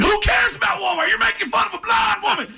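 very high-pitched indignant yell; outraged crowd. Retold or imitated. Crowd turns on the opening comic, outraged yell. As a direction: heckle like an appalled audience.

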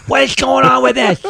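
high lisping angry boss; shrill, lisping scold over burnt toast; slushy lisp. Retold or imitated. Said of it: "he had a lateral lisp".

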